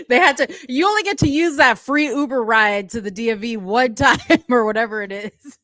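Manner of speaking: high-pitched talk through laughter; laughing. Real, not acted. Co-host joking about the free Uber ride, laughing. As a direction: laugh your way through the sentence.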